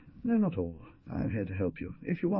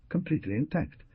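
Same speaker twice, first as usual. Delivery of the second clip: ordinary delivery, nothing marked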